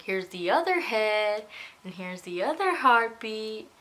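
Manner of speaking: slow right down and lean hard on "other"; emphatic reveal. Retold or imitated. Tech revealing twins in retelling.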